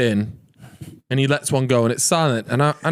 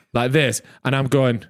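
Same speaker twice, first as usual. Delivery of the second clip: ordinary talk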